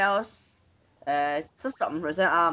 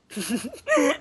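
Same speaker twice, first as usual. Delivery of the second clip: falling laugh; 9 semitones higher than usual. Real, not acted. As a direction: giggle trailing down. Wrong guess.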